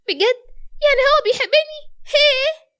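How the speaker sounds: excited disbelief. Acted. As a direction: squeal it out, thrilled she's loved. Mirna hears Youssef loves her. (بفرحة ودهشة وصوت عالي)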